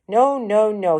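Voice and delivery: big man shouting down the audition. A narrator's reading. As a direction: bark the refusal. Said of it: "he shouted".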